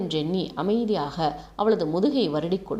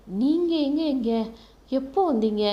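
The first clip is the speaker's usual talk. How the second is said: higher-pitched, tentative question; hesitant; 6 semitones higher than usual. A narrator's reading. Girl surprised to find him here, asking hesitantly. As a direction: ask it with hesitation in a raised voice. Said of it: "தயக்கத்துடன்".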